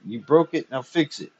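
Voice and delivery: angry. A narrator's reading. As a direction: throw the blame back. Angry argument.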